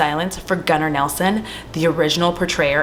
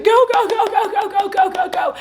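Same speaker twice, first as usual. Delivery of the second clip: frantic urging. Retold or imitated. Sally telling the truck driver to drive, frantic. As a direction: hurry him on in mock panic.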